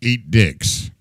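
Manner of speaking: flat robotic game-character read; broken NPC voice. Pretend. Streamer reads chat's line as a broken NPC, robotic. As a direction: say it like a glitching NPC. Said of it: "sound like a broken N P C".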